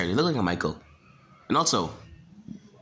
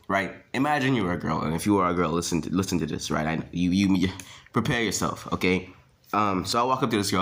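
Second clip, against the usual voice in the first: usual speaking voice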